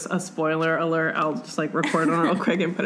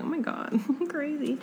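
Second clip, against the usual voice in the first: giddy laugh; 9 semitones higher, 6 dB quieter than usual. Retold or imitated. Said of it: "She's like".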